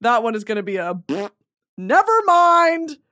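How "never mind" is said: high, slow, loud cutoff; loud drawn-out never mind. Real, not acted. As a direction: call it off loudly and slowly. Abruptly drops the story.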